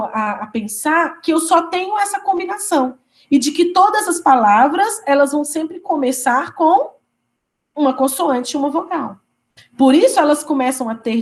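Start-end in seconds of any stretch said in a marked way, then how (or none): none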